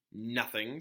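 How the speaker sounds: ordinary delivery, nothing marked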